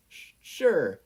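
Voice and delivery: high stammering reply; shaky nervous stammer. A narrator's reading. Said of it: "Izuku nervously nodded".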